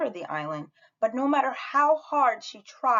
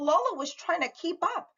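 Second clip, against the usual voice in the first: plain reading voice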